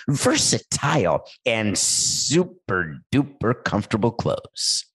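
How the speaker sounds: playful, sing-song commercial read; sponsor ad read. Acted. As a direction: sell each phrase, stretching the key words. Reads the sponsor ad.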